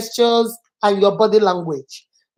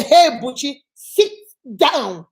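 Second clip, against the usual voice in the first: stern command; 2 semitones higher, slower than usual. Shown as a demonstration. Teacher showing Butchie ordered to sit down, sternly. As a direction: order them to sit, splitting the last two words. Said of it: "So you see, so does it make a difference?".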